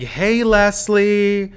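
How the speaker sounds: slow, high, loud call down the street; stranger calling out. Retold or imitated. Stranger on the street hailing Leslie, loud and high. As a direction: call out a name to a celebrity passing by. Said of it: "some guy walking down the street was like".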